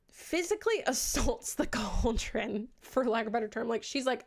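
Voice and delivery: ordinary delivery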